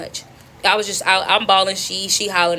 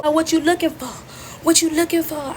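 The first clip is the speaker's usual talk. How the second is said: higher-pitched mocking question; acted irritated taunt; irritated mimicry; 6 semitones higher than usual. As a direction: needle them with a put-on voice. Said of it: "So now I'm irritated".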